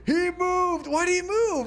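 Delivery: high, loud disbelieving cheer; excited yelp. Real, not acted. Streamer watching his army finally move, high excited yelp.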